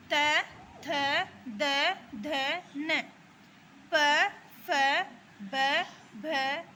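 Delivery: teacher reading out the Hindi consonants to the class; reciting the consonants. Shown as a demonstration. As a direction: sound out each consonant with a short pause between. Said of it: "देखिए व्यंजन कौन कौन से होते हैं".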